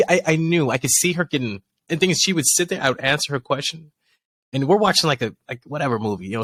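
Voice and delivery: usual speaking voice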